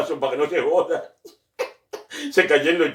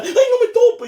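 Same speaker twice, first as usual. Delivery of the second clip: mock fear; 7 dB louder than usual. Scared patient at dentist.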